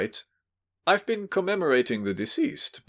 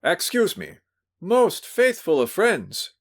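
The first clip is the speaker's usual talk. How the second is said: put-on high, effusive drunken greeting; crying out; 10 semitones higher, 4 dB louder, slower than usual. Drunk host greeting his guest, crying out happily. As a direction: gush it out, overjoyed to see him. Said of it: "he cried".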